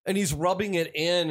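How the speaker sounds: ordinary delivery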